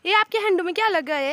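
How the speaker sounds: high, loud playful questioning. Real, not acted. Adult playfully quizzing a toddler, high and loud. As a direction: tease the little one about their messy face. Adult coaxing small child.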